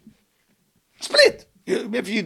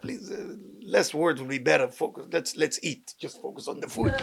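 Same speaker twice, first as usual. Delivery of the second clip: hushed aside to wife; 4 semitones lower, 8 dB quieter than usual. Retold or imitated. Quietly telling wife at dinner.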